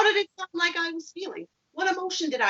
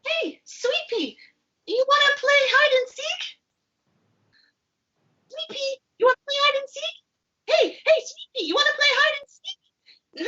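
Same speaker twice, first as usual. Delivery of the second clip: high cartoon voice, pleading to play; a little pea begging a friend to play, high and eager; eager puppet pea; 12 semitones higher than usual. Sugar Pea asking Sweet Pea to play hide and seek, eager and high.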